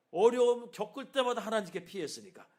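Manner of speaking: fervent sermon emphasis; forceful preaching. Real, not acted. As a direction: proclaim it with full force.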